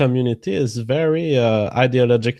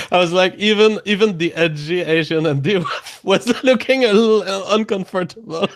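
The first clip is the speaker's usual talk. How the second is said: words broken up by real laughter; laughing; 6 semitones higher than usual. Real, not acted. Co-host recalling the Uber clip, laughing as he speaks. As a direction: laugh your way through it.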